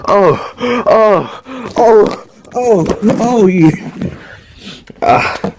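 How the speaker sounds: hammed-up loud wailing sobs; mock crying. The other side of me reacting, hammed-up loud wail. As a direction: imitate a loud, high sob over the scene. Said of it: "the other side of me was like".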